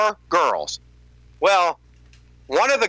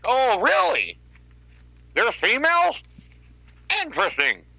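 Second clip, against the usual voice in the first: higher mock astonishment on females; sarcastic fake surprise; 6 semitones higher than usual. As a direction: sarcastically act amazed. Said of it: "I'm like".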